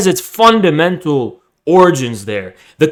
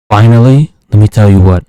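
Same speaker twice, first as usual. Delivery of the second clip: louder, lower "let me tell you what"; loud announcement; 4 semitones lower, 6 dB louder than usual. Real, not acted. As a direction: call it out for the punchline. Build-up before a final insult.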